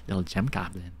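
testing the goblin voice, a touch spitty; gem goblin voice. Shown as a demonstration. Streamer practicing goblin voice for roleplay, little goblin voice. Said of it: "Gotta get my gem goblin voice".

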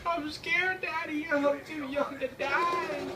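put-on high, slow whiny child voice; scared little kid. Gamer under fire whimpering to daddy in a high kid voice. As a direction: beg like a frightened little child.

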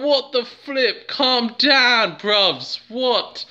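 high, incredulous imitated reaction; mock exasperation. Brit telling frenzied shoppers to calm down, mock exasperation. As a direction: act baffled by all the fuss.

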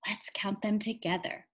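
soft whispered invitation to count. Acted. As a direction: lean in and whisper it to the kids. Teacher whispers to counting children.

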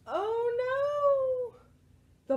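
high, drawn-out "oh no"; story-time dismay, stretched and high; dismayed cry. Acted. Reader voicing the lost-ball moment to children, high dismay.

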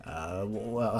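normal talking voice

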